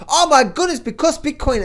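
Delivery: mock hyped buyer. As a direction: gush like a starry-eyed buyer.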